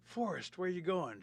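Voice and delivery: high, loud question; hailing call. Retold or imitated. Storyteller springing punchline on listener, high hailing call.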